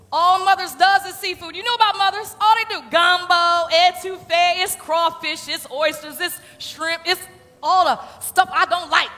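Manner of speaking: loud, lively list; excited. Real, not acted. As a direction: rattle it off with gusto. Excited list of hated seafood.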